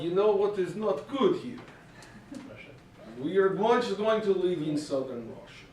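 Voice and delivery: mock Russian migrant accent. Migrants talking among themselves about leaving, Russian accent. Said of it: "losing their Russian accent".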